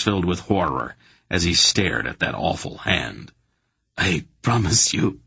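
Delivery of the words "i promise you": stammering. A narrator's reading. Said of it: "he stammered".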